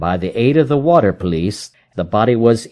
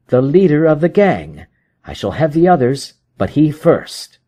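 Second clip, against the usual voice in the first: higher, firm detective voice; Holmes, cold determination; 6 semitones higher than usual. Acted. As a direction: spin the threat calmly.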